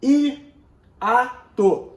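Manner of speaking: "Hiato" split slowly into three beats, hi-a-to; syllable by syllable. Shown as a demonstration. Teacher showing the word has three syllables, syllable by syllable. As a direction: separate each syllable of "Hiato". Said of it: "São três sílabas".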